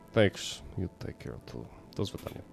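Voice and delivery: put-on low Russian-accented farewell; bad Russian accent. Neighbor says goodbye to player, bad Russian accent. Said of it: "bad Russian accent right now for your neighbor".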